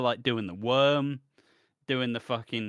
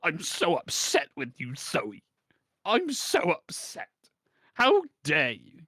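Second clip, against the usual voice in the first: high, hammed-up hurt complaint; mock wounded outrage; 5 semitones higher than usual. Jilted man scolding the woman, mock wounded. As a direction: play the wounded lover, overdone. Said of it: "Anthony's like".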